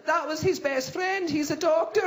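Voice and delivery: high, slow comic whine; mock-upset girlfriend; distraught woman. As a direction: explain it in a wailing high voice.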